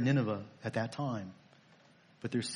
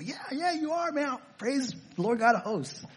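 much higher, breezy mock churchgoer saying Oh yeah, yeah; glib believer; 10 semitones higher than usual. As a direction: toss it off like a careless believer. Mocking believer's glib reply.

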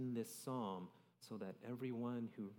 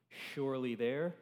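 surely punched higher and much louder; stress on surely; 4 semitones higher, 10 dB louder than usual. Shown as a demonstration. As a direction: hit the word surely hard. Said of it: "stresses some sort of".

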